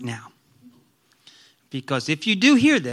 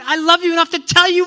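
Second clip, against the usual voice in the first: usual speaking voice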